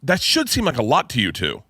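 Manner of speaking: dad baffled his count seems small; exasperated. Retold or imitated. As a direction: push it up in incredulous protest. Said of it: "And I was like".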